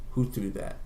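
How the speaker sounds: low, dangerous question to the crowd; a gang boss quietly threatening the crowd; menacing gang boss. Retold or imitated. Angry gang boss demands.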